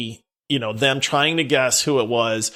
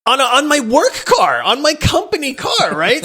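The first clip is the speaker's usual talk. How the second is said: excited, rising disbelief; 11 semitones higher, 4 dB louder than usual. Real, not acted. Telling a funny shock.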